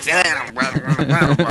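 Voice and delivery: Boston fan telling you to sit; Boston accent. Retold or imitated. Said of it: "that's spot on".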